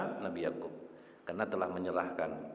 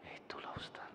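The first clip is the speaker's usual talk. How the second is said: soft, unvoiced whisper; whispered confession; 16 dB quieter than usual. Hospital patient confessing a grudge to the ustaz, whispered. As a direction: admit it under the breath.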